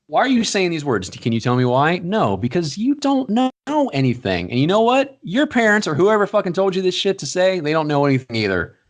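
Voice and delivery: high, incredulous telling-off; grilling a kid who swore on TV, mockingly high; mock scolding. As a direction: lecture the kid like he's clueless. Scolding imagined kid, pitch high.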